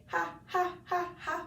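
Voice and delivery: "Ha, ha, ha, ha," each opening with a clear breathy H; breathy ha syllables. Shown as a demonstration. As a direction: aspirate every "Ha" sharply. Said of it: "with the H".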